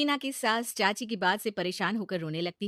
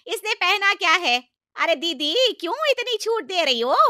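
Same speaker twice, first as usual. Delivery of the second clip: high, loud, outraged complaint; aunt yelling about the bride's clothes; loud outrage; 9 semitones higher, 10 dB louder than usual. Acted. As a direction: yell in outrage at the sister. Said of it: "इतना क्यों चिल्ला रही हो".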